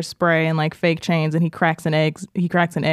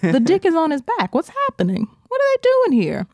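mock confused viewer; 9 semitones higher than usual. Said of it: "one angle where you're like".

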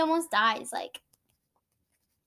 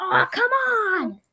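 frustrated fan yell; 7 semitones higher, 8 dB louder, much slower than usual. Retold or imitated. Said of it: "your dad just goes".

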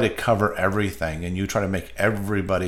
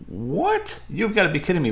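sharply higher, stunned "What?"; shocked disbelief; 9 semitones higher than usual. Retold or imitated. To his boss, retelling being told his pay is capped, in stunned high disbelief. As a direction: replay the jolt of hearing your pay is capped.

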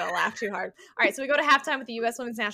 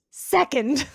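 "Second" punched higher and louder; stress on Second; 4 semitones higher, 8 dB louder than usual. Real, not acted. Correcting the minute to a second, stress on "Second", raised and loud. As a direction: hit "Second" hard as a correction. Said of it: "not minute".

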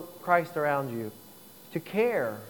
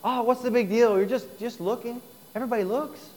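higher, scoffing tempter voice; dismissive coworker; 6 semitones higher than usual. Retold or imitated. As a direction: brush it off like it's nothing.

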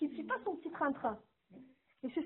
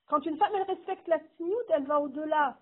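ordinary talk

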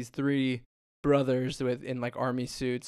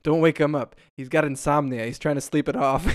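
louder, punchy delivery of the gag; loud mock warning; 9 dB louder than usual. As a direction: bark the joke out loud. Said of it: "he's like".

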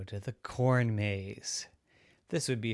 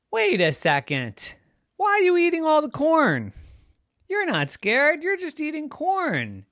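surprised parent; 8 dB louder than usual. Acted. Parent surprised at corn eating.

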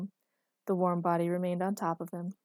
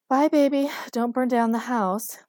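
loud, higher, slowing farewell; called-out goodbye; 5 semitones higher, 10 dB louder than usual. A narrator's reading. Man calls goodbye to girlfriend under the covers, loud. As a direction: call it out on the way off.

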